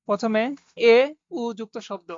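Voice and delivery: ordinary talk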